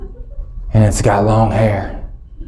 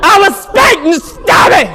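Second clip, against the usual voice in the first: high, fast, loud panicked yelling; much higher, louder, faster shouting; frantic shrieking; 5 dB louder than usual. Retold or imitated. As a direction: flail and shriek in comic panic. Dad frantic during vomiting.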